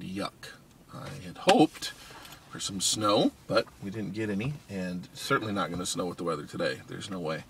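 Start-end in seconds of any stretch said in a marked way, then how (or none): none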